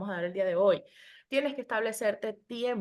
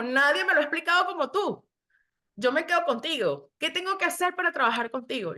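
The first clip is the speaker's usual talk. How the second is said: grateful client quote; 6 semitones higher, 4 dB louder than usual. Retold or imitated.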